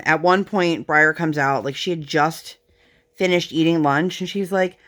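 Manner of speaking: normal talking voice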